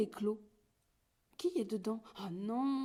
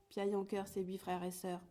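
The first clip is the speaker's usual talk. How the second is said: usual speaking voice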